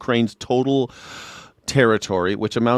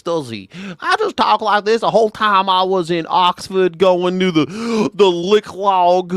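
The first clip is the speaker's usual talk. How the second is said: high, loud mock-British drawl; mocking a lawyer's put-on Oxford voice; mock posh English accent; 9 semitones higher, 5 dB louder, slower than usual. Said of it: "he talked like this".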